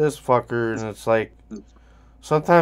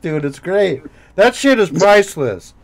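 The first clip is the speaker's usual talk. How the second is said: usual speaking voice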